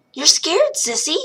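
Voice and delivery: put-on a small boy teasing his sister gently; sweet little boy. A narrator's reading. A hint of the little brother Henry asking his sister. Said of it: "in his sweet little voice".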